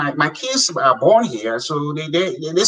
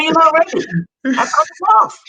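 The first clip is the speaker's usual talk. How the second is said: much higher and louder, almost crying out; raised retort; 13 semitones higher, 4 dB louder than usual. Retold or imitated. Man scolding village men who would not apply, much higher and louder. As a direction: hammer the point home at the village men. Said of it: "I said".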